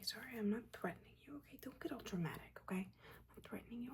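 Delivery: normal talking voice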